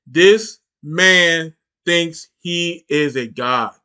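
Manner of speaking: each word spaced out slowly for weight; slow, deliberate restatement; slow emphatic repeat. Shown as a demonstration. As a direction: drag it out so it sinks in. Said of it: "repeated for emphasis".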